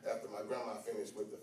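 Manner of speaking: low, hushed, quick anecdote; soft storytelling. Acted. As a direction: chat quietly like a memory.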